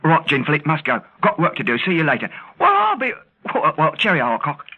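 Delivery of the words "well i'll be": very high-pitched exclamation of wonder; pleasantly flabbergasted; astonished. Acted. Still amazed, ending the call.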